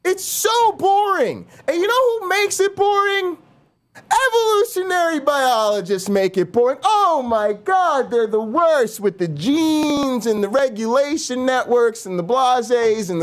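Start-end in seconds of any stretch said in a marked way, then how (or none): 0.0-1.5 high, slow bored whine; whiny drawl. Pretend. Mocking whine about boredom. 1.7-9.3 high, mocking rant voice. Mock rant at biologists.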